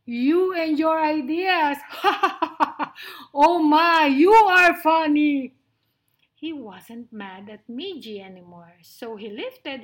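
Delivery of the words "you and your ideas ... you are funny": loud, high, hearty acted laughter; laughing lion. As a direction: guffaw at the mouse's ideas. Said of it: "laughed Lionel".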